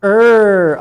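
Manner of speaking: the r-colored er shown loud; er sound demo. Shown as a demonstration. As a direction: pull the tongue back into a strong er.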